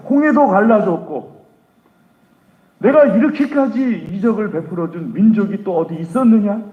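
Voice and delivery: wrathful God. Acted. God rebuking the people to Moses, in furious booming anger. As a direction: thunder down on a faithless people. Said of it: "진노하셔가지고".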